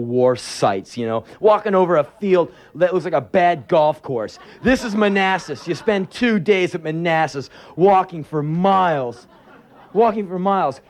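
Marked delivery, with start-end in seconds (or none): none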